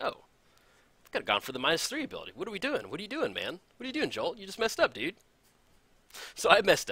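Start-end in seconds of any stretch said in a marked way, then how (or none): 0.0-5.2 mock self-scolding, higher and pushed; lightly hammed-up scolding of his own mistake. Replaying scolding himself mid-game over the missed Elspeth, mock-exasperated and high. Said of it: "I was like".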